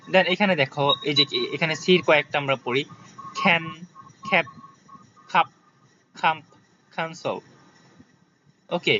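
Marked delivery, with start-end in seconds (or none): 3.4-7.4 "খ্যান", "খ্যাপ", "খাপ" with puffed k; separated model words, airy k as in "খ্যাপ"; aspirated K. Shown as a demonstration. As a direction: drill "খাম্প" and "ক্যান্সেল" with kh onsets. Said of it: "ক্যানকে খ্যান বলবা".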